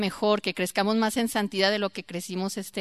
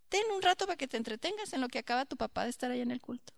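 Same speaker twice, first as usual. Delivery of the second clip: quick, soft offhand voice; quietly mocking a lax teacher; soft careless teacher; 2 semitones higher, 10 dB quieter, faster than usual. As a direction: hand it off without care, softly. Voicing teacher handing phone.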